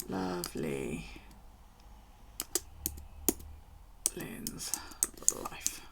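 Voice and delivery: hushed, whispered name read out. Real, not acted. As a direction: read the name softly to herself. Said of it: "I'm trying".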